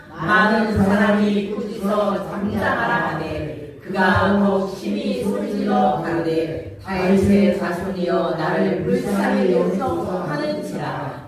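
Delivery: slow, low unison scripture reading; congregation reading. Shown as a demonstration. Congregation reads the scripture slowly together. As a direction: read the verse slowly with the congregation. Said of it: "시작".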